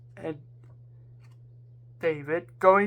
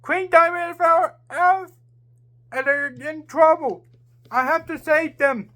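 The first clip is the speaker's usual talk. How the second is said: shout; 7 semitones higher than usual. A narrator's reading. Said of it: "James shout".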